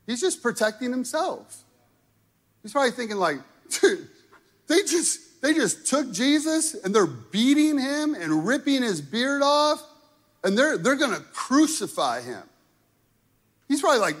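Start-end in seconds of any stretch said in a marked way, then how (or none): 3.7-12.4 high, loud, frantic rush of panic; panicked Peter. Retold or imitated. Peter panicking to himself as Jesus is beaten, high and loud. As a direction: blurt it out like a terrified follower.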